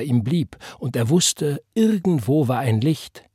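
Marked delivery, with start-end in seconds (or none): none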